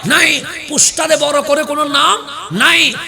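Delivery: loud, rousing sermon voice; fiery preaching. Acted. Preacher rousing the gathering about Abdul Qadir Jilani, fiery sermon voice. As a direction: preach it out to the whole crowd. Preacher addressing the waz crowd.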